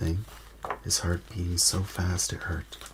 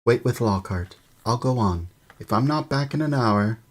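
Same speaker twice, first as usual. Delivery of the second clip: loud, high call; 6 semitones higher, 6 dB louder than usual. A narrator's reading. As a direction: call across the rubble. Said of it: "he called to Ron".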